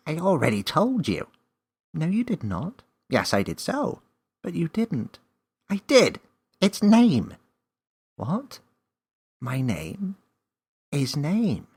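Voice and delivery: high, loud back-and-forth; childish squabble; bickering voices. A narrator's reading. Elf and Millie bicker.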